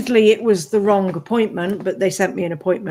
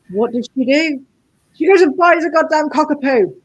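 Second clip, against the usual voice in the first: loud, high exasperated outburst; 8 semitones higher, 8 dB louder than usual. Real, not acted. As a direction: throw up your hands in disbelief. Exasperated about mum's purchase.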